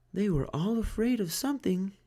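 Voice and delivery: high, soft worried mum; relieved mother. A narrator's reading. Mother finds Franklin, high and relieved. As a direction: sigh it out in relief.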